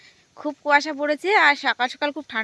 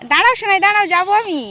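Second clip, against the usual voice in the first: raised, higher-pitched call; calling after someone; 7 semitones higher, 5 dB louder than usual. Real, not acted. Parent calling after a child running off to fish, urgent call.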